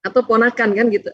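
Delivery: usual speaking voice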